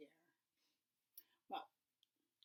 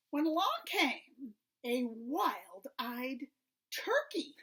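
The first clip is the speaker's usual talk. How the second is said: dramatic reveal; 2 semitones higher, 7 dB louder, slower than usual. A narrator's reading. Dramatic turkey entrance.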